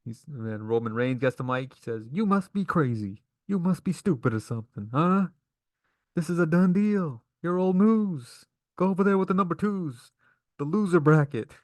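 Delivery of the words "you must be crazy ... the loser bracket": mad taunt. Retold or imitated. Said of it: "Roman Reigns is all mad and stuff".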